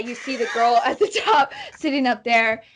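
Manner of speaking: ordinary delivery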